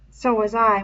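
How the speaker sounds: ordinary delivery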